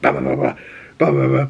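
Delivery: rapid "bah" bursts as gunfire; imitated machine-gun fire. Gunner shooting at the player from a truck, imitated gunfire. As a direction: mouth two bursts of machine-gun noise. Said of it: "like, a machine gun on the top".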